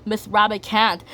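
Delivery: ordinary delivery, nothing marked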